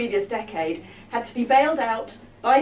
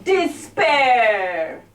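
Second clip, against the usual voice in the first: slow, drawn-out mock Jamaican title; Rastamouse patois; 4 dB louder than usual. Comic titles a Rastamouse-inspired poem, mock patois. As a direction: announce it in put-on Jamaican patois. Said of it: "more practice on this accent".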